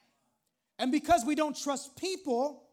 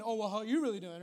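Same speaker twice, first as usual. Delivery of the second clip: mock deflection; 2 semitones lower, faster than usual. Joker avoiding a serious conversation, deflecting question back.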